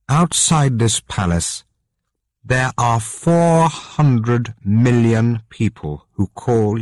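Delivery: queen mother reasoning with the king, slow; stern queen pleading. Acted. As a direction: press him slowly with a hint of reproach. Queen voiced in the story.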